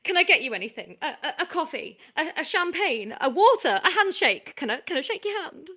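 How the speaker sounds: loud, breathless, gushing fan; hammed-up starstruck gush. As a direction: fawn over the star, tripping on words. Starstruck fan meeting her idol.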